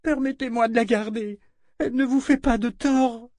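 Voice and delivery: put-on high, begging child's voice; frightened pleading. A narrator's reading. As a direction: plead through pain and fear. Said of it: "gémit Rachel, tremblant de douleur et de peur".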